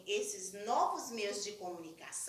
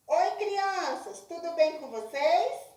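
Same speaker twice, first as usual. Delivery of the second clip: bright, raised voice into a tin-can phone; right in your ear; 6 semitones higher, 7 dB louder than usual. Shown as a demonstration. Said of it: "Vai colocar o copo no ouvido e falar".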